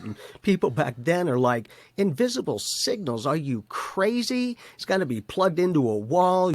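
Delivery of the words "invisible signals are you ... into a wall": mock incredulous skeptic. Mocking skeptics of Tesla's day.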